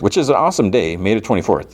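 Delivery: ordinary talk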